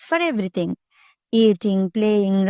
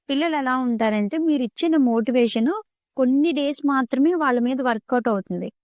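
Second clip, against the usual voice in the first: ordinary talk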